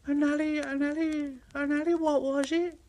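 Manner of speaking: high, puzzled stumbling; stumbling little engine. Acted. Thomas fumbling the word alliance. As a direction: trip over the long word.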